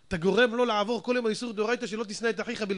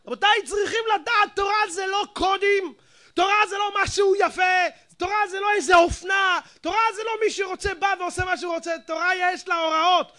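higher, louder hammering sermon; fiery preaching; 8 semitones higher, 5 dB louder than usual. Acted. Rabbi rebukes his listeners about Torah, shouting high. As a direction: thunder at the congregation.

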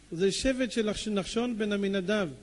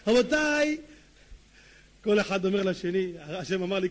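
normal talking voice

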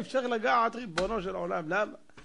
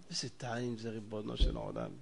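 much softer, low sigh over the wine; hushed longing; 12 semitones lower, 11 dB quieter than usual. Acted. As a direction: murmur it like a secret craving.

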